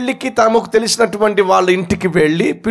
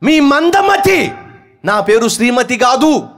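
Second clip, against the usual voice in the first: angry shout; 5 semitones higher than usual. Acted. Wife retorting to husband.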